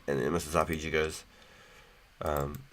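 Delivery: ordinary delivery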